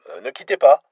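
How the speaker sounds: a flustered desk clerk answering; receptionist. A narrator's reading. Receptionist hesitantly answering an unexpected foreign caller.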